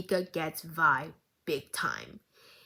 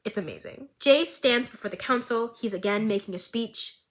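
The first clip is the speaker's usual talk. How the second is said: ordinary talk, nothing marked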